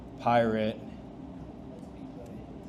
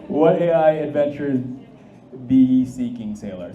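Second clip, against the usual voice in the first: pirate voice; 7 dB louder than usual. Acted. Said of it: "You have to read it in the pirate voice".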